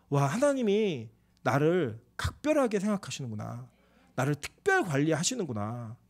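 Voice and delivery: hushed wonder; tender realization. Real, not acted. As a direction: realize you're cherished. Tender realization of God's care.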